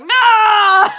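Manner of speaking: a loud, high cry of NO; anguished shout. Retold or imitated. Watching Peaky Blinders, she cries out at the death. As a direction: wail it out in mock despair. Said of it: "I'm like".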